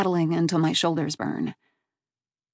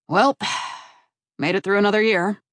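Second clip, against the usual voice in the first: gruff voice. A narrator's reading. Assistant director to her boss after camp ends, lightly gruff. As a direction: sigh it out with a touch of gruffness. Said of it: "a gruff voice says".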